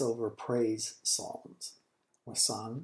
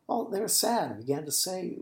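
usual speaking voice

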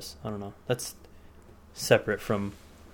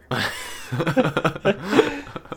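burst of laughter; 7 semitones higher than usual. Real, not acted. Laughing at own story.

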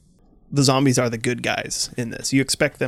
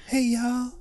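high breathy celebrity voice, lots higher; 11 semitones higher than usual. Acted. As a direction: squeak it up like the pop star. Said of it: "a [name] voice zombie".

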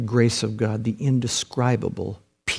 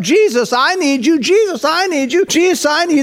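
high, insistent pleading; urgent crowd begging, acted out; clamoring for attention; 5 dB louder than usual. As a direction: cry out over and over, tugging for help. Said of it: "they pulled on his robe".

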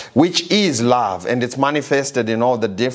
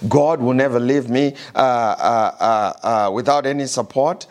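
ordinary talk

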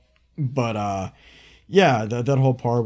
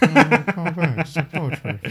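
George Lucas impression; 4 semitones higher, slower than usual. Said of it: "Like George Lucas said".

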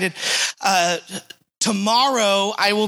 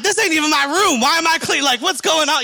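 indignant protest; 9 semitones higher, 4 dB louder than usual. Retold or imitated. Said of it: "I'm like".

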